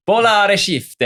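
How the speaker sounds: high, loud hammed-up Italian accent. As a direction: say it like a cheesy Italian, with a trailing e. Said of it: "machen wir es einfach mit italienischem Akzent".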